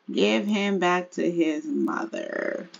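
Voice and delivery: drawn-out, measured instruction; slow, careful rewording. Pretend. Player handing baby back to his mother, slow and deliberate. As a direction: stretch it out slowly, word by word. Rewords it slowly after laughing.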